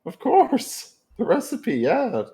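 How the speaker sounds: high, loud granny voice; Grammy voice. Acted. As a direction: play a sweet old granny, high and bright. Goblin still voicing Grammy.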